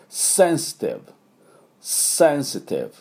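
"センシティブ" as English sensitive, with hissing initial S; pronunciation demo. Shown as a demonstration. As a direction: model "センシティブ" with a strong S. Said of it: "Sの音がもうポイントです". (「センシティブ」のSを強調した英語発音)

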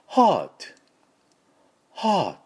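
just a sighed "haa" and a t, British style; British pronunciation. Shown as a demonstration. Teacher contrasting how British people say heart, British pronunciation. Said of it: "イギリス人はこのRを無視して".

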